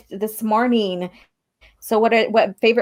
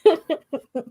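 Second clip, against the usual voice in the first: giggle; 7 semitones higher than usual. Real, not acted. Laughing at Kenobi voice.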